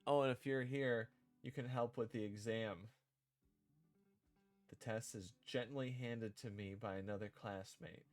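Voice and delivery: ordinary talk, nothing marked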